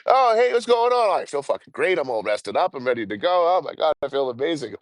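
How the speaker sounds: high, bubbly imitation of mom; cheery mom. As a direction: play her chirpy and upbeat as if nothing happened.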